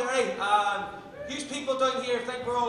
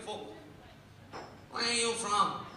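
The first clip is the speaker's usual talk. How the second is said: a light imitation of a surprised barman; imitating barman; 3 dB quieter, slower than usual. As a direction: toss it off like a Dublin barman. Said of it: "He was like".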